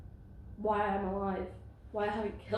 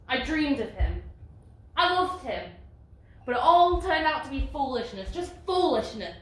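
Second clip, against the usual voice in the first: higher and louder, cracking with bitterness; anguished outburst; 5 semitones higher, 9 dB louder than usual. Acted. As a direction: let the hurt spill out. Dreams collapse into bitterness.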